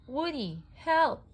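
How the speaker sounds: high, loud, desperate plea; pleading cry for help. A narrator's reading. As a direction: beg him to save you. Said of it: "pleaded Jessie".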